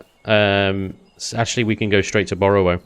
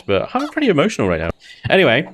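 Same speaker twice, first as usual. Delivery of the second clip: usual speaking voice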